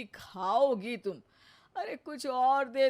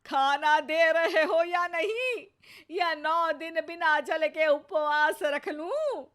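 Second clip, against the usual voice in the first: high, loud demand, slowing on the threat; mother-in-law threatening a full fast unless fed; 7 semitones higher, 6 dB louder than usual. Acted. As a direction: make the threat sound serious. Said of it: "देने के लिए धमकी अच्छी है".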